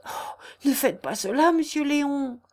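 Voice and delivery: acted fearful entreaty; frightened. A narrator's reading. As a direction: beg him in alarm after a gasped "oh". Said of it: "dit Jeanne effrayée".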